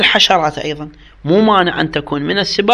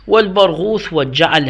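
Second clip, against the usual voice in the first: normal talking voice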